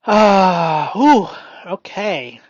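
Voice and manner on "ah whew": a big loud whew of release; loud relieved exhale. Real, not acted. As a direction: blow it all out, loud. Loud release after exercise.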